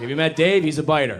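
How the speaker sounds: loud, laughing mock intro; mock introduction. Comic mimics friends introducing Dave, loud and laughing. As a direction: bellow it like a proud friend.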